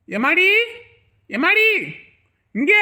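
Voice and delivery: high, rising call to someone. Acted. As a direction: call out for her, pitch lifting at the end. Calling someone in the house. (உரக்கக் கூப்பிடும் குரல்)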